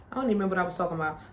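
fast, quiet, flustered muttering; rushed and quiet. Real, not acted. Mother, flustered after chasing her toddler, rushing quietly. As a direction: rush it out softly.

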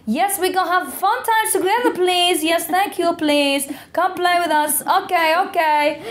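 very high, pushy rep in a bad accent; mock an over-keen entertainer rallying in accented English; accented resort rep. Rep trying to get sunbathers into yoga, high accented cheerleading. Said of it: "that's a very bad accent".

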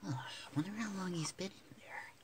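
soft whisper to avoid spooking him. Real, not acted. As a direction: keep it a hushed whisper. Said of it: "sorry for whispering".

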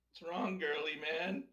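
mocking taunt, pitched high and slow. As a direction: sneer at him like a bully. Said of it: "I'm gonna start talking to him again".

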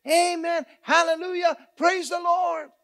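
high, loud shout of praise; belted-out, high-pitched praise; excited church shout. Retold or imitated. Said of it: "she would get so excited".

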